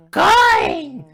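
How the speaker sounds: very high, loud shriek; crazed piercing yell; screaming. Acted. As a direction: shriek the single word at the coin. Escalating shouting exchange with coin.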